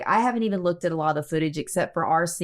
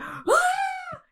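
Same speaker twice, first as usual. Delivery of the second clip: high yelp of fright, imitated; mock startled shriek; startled scream. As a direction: shriek as if a feather fell on you. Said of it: "and then she went".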